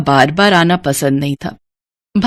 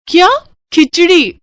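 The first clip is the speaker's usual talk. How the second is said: aghast at being served khichdi; shocked question; 8 semitones higher than usual. Acted. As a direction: pitch jumps up in disbelief. Niece shocked by the diet. (चौंककर ऊँची आवाज़ में सवाल)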